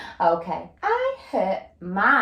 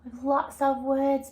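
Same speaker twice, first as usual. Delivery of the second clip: mock amazed gasp; 10 semitones higher, slower than usual. Mock amazement at a long sentence.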